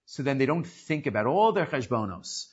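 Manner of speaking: normal talking voice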